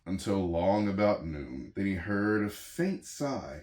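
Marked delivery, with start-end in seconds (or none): none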